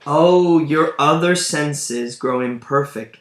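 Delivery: loud dramatic sigh of pity, then the line; loud exclamation. Acted. Edgar loudly pitying blind Gloucester, exclaiming. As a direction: burst out with a loud Oh.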